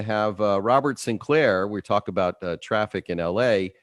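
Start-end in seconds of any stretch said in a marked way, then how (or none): none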